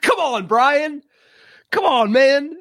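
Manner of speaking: can't believe what you just heard; exasperated disbelief. Real, not acted. Host groaning at the coach's accent, exasperated disbelief.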